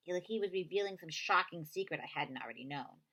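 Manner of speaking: ordinary delivery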